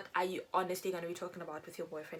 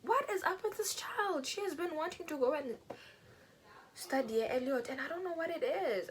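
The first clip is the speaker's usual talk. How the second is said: exasperated mom; 9 semitones higher than usual. Retold or imitated. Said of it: "And she was like".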